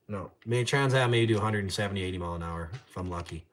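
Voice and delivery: normal talking voice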